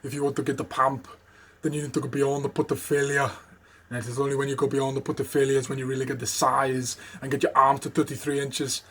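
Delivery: put-on Austrian Schwarzenegger accent, rumbling from the chest; Arnie impression. Retelling his old Arnie bit, Austrian accent. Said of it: "a bit of an Arnie impression".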